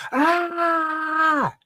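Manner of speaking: a long sustained high cry of release; yell. Retold or imitated. Runner finishing on the track yells out release, long high cry. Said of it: "I just yell".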